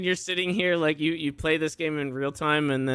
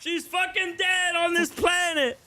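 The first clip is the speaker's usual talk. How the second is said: excited shout; 13 semitones higher than usual. Real, not acted. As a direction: yell it in excited disbelief. Shouting at outcome.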